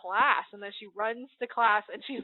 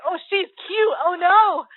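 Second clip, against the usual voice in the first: real fangirl squeal; gushing; 8 semitones higher, 10 dB louder, slower than usual. Real, not acted. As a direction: squeal at the cuteness. Gushing over cute character.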